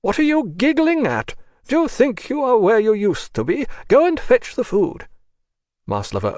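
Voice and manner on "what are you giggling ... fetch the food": much higher, louder scolding nurse; shrill-free sharp reprimand, pitched way up and loud; angry rebuke. A narrator's reading. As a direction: snap at her like a cross ward sister. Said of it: "rebuked her angrily".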